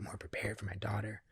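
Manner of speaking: soft whispered plea; whispered prayer. Retold or imitated. Asking spirit for help, whispered. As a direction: breathe the request like a prayer.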